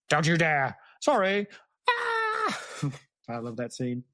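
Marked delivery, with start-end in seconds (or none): 0.1-1.5 louder, rising into a cry; a hint of a scolding aunt breaking off; mock Marge outburst. Acting out Marge blowing up. 1.9-2.6 very high mock scream; hammed-up shriek. Marge inflating and flying.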